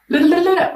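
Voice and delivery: high-pitched babbling over a flub; playful high run of nonsense syllables; tongue-tied babble. Real, not acted. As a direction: shake out jumbled words in babble. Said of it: "My words are all messed up".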